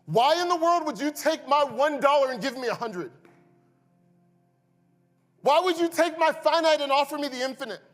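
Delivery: a man who can't believe the trade he's been offered; stunned disbelief. Acted. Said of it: "You see the disbelief?".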